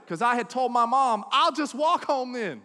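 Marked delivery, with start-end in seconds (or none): 1.3-2.6 loud, high, drawn-out retort; defiant retort. Retold or imitated. Kid had told mom, defiant loud retort. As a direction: throw it back slowly and loudly.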